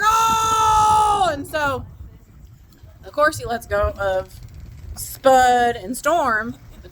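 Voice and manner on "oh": long, loud, high imitated wail; hammed-up "oh" held out in pain; mock cry of pain. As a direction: holler like someone hurt. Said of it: "crying out in pain".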